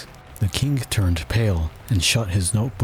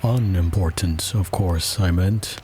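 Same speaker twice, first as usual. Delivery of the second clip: ordinary delivery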